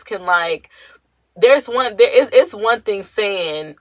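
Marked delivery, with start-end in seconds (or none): none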